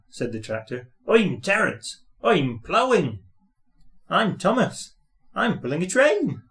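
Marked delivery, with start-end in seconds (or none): 1.1-3.2 lightly voiced bright tractor; a hint of a proud, chirpy tractor; cheerful tractor. A narrator's reading. Terence the tractor greets Thomas, lightly cheerful. 4.1-6.4 higher, proud engine voice; proud little engine. A narrator's reading. Thomas answers the tractor, higher and proud.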